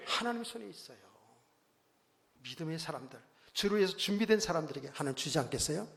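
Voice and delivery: ordinary delivery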